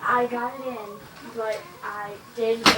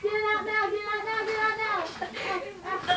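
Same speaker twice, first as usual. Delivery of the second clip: urgent pleading; 8 semitones higher, faster than usual. Real, not acted. Trapped, yelling to be freed.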